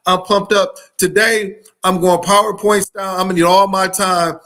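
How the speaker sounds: ordinary talk, nothing marked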